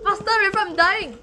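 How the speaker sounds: high, loud desperate yell; shouting. Real, not acted. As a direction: yell that you're dying. Dying in game, urging friend.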